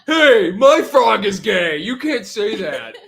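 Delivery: high, loud, drawn-out protest through laughter; mock offended yell. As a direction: yell it slowly, half laughing. Acts out an offended viewer.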